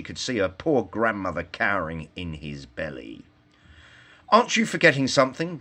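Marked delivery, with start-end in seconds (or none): 4.3-5.6 higher, louder, fast indignant shout; brave shout. A narrator's reading. Girl bravely shouting at the grabbing wolf.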